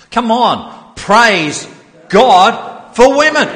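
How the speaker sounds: high, loud shout to a whole auditorium; loud rallying cry. Shown as a demonstration. Preacher urging the congregation to praise, loud high cry. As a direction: call it out big and ringing. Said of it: "really loud, like, reverberating cry".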